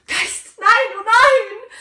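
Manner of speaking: high, slow, strained wail of denial; anguished no. Acted. Scrooge begging the Ghost at his own grave, anguished high wail. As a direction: stretch the no into a despairing wail.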